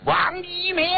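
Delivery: furious commander's yell; angry roar. A narrator's reading. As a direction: roar the name in accusation. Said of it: "大声吼道".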